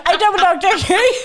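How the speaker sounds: put-on Derry accent. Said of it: "when you do that accent".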